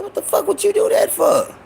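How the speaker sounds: high, mock-outraged yell at his sister; retold angry outburst. Boy yells at sister for showing mama the ring, high mock outrage. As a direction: replay the shock of being ratted out, pitched high.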